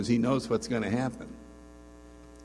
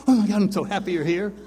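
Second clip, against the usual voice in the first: mock overjoyed; 8 semitones higher, 6 dB louder, faster than usual. Imagined overjoyed welcome to God.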